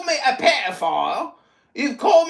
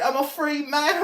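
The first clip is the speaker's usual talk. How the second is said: ordinary talk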